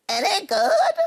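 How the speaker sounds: high, put-on sheep question; sheep asking. A narrator's reading. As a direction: ask hopefully as the sheep. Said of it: "they asked".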